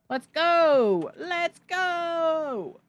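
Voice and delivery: high, drawn-out victory cry; triumphant cheer. Real, not acted. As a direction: cheer and hold the last word long. Celebrating a win in the game.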